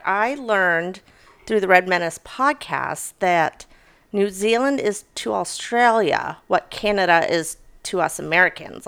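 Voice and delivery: normal talking voice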